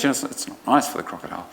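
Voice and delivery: ordinary delivery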